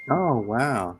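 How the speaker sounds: normal talking voice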